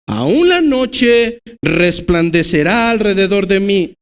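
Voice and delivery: slow, solemn reading of a psalm; scripture reading. Acted. Preacher reading a psalm to the congregation, solemn. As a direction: read the verses slowly and reverently. Reads psalm aloud in sermon.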